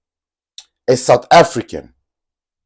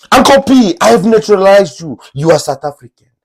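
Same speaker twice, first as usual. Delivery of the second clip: a hammed-up fan, higher and louder; mock fan voice; 8 semitones higher, 4 dB louder than usual. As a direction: read the fan's comment pitched up. Reads fan comment aloud.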